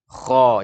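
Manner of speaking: KHO read heavy; thick kho. Shown as a demonstration. Teacher shows how it should be read, thick kho. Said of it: "akan dibaca dengan tebal".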